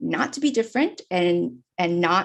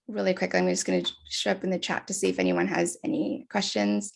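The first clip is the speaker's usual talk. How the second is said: ordinary delivery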